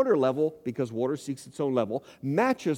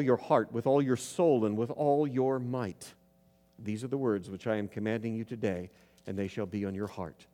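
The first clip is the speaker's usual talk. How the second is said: measured, solemn reading of the passage; scripture reading; 4 semitones lower than usual. Acted. Preacher reading Deuteronomy aloud to the congregation, solemn.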